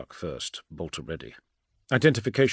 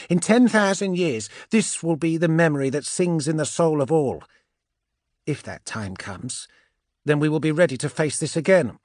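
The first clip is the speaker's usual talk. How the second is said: high, loud, fervent seer; impassioned, high-pitched character oration; Andromeda, prophetic; 8 semitones higher, 6 dB louder than usual. Acted. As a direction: proclaim a prophecy in a high witch's voice.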